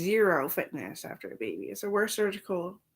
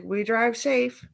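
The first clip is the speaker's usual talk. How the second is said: mock solemn; 5 semitones higher, 9 dB louder, much slower than usual. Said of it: "I was like".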